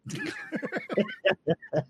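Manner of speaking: a sudden high-pitched laugh breaking out. Real, not acted. Host laughing at his own joke to his co-host, high laugh.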